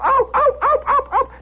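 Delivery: very high, quick yelps; barking dogs. Retold or imitated. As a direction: yap like excited hounds. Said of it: "The dogs are going".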